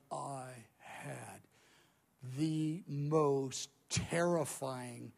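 hushed, very slow retelling; shaken friend. Retold or imitated. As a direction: imitate someone in awe, each word drawn out. Friend voiced recounting terrifying encounter.

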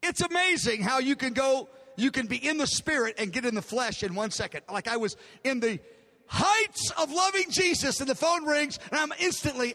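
normal talking voice

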